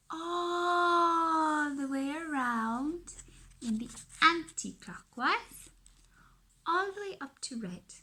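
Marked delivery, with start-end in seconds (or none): 0.1-3.1 slow, stretched and louder; drawn out slowly. Shown as a demonstration. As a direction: stretch it while tracing the circle. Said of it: "and we go from green".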